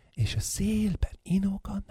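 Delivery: unvoiced hushed whisper. Whispering about the swaying wind. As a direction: breathe it out secretly.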